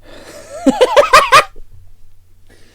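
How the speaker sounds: high, loud laughing fit; loud burst of laughter. Real, not acted. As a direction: crack up loudly. Bursts out laughing after the joke.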